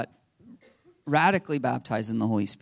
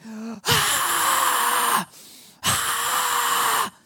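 high mock scream, held back in volume; long high-pitched imitation shriek; imitated scream. Pastor imitating woman screaming during prayer, mock scream. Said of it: "she just starts screaming".

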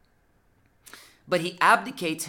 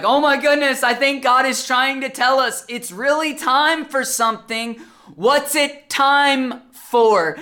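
high, loud mock amazement; excited realization; 10 semitones higher, 11 dB louder, slower than usual. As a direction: act out an astonished discovery, stretching the final question. Retold excited family realizing message.

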